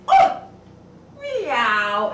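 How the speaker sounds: ordinary delivery, nothing marked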